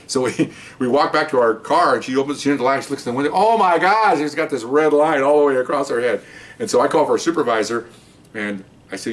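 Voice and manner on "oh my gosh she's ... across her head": raised-pitch mock dismay; retold alarm. Seeing her welt in the car light, mild retold shock. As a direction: exclaim it higher, a touch of alarm.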